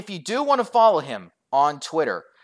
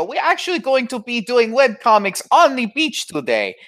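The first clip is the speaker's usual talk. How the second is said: put-on Jamaican accent; 8 semitones higher, 4 dB louder than usual. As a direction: ham up a loud, lilting Jamaican accent. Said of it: "this is the English accent".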